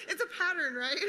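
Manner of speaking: high, loud laughing aside; amused self-mocking laugh; laughing through words. Real, not acted. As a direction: laugh it out at yourself. Laughs at own repeated graveyard story.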